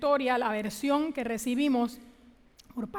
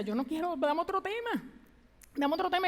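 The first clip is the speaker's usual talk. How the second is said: higher, pleading, near tears; tearful pleading; 5 semitones higher than usual. Retold or imitated. Preacher recalling a tearful prayer to God, pleading higher. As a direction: beg God to take it away. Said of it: "yo con Él llorando".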